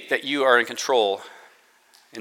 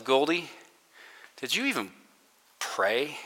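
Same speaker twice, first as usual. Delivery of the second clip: much softer, almost hushed self-questioning; inner self-rebuke; 11 dB quieter than usual. Retold or imitated. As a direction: ask yourself quietly, a little ashamed.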